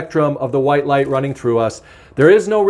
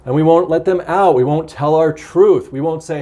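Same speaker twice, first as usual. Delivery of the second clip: ordinary delivery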